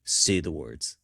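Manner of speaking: low-pitched mock puppeteer's command; Jensen. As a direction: imitate a puppet master prompting, pitched low. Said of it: "Jensen has the mouth puppet and he's like".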